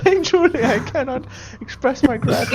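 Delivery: high voice full of laughter; effusive gratitude. Acted. Worshipper just ordained by the god, effusively grateful. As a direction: gush thanks, overcome and giggly.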